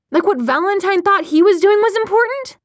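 high, loud outraged question; incredulous retort. A narrator's reading. Clary answering Jace's plea to listen, incredulous and heated. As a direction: throw it back, voice climbing high.

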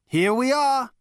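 loud, high, insistent voice; dogged. Acted. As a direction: push on stubbornly. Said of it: "continued Zaphod doggedly".

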